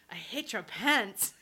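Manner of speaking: imitating his Spanish-accented English; slow, mock Spanish lover accent. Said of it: "was that your Spanish accent?".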